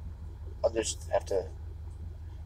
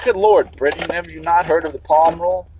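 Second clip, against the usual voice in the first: higher, louder mock scolding; raised-voice playful rebuke; mock exasperation; 7 semitones higher, 6 dB louder than usual. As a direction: exclaim as if lightly appalled at a whole country. Acts out scolding of Britain.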